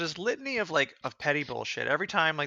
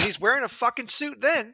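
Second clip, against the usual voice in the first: louder, higher, exasperated; raised indignant voice; indignant outburst; 8 semitones higher, 5 dB louder than usual. Real, not acted. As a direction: hit it with disbelief. Indignant rant.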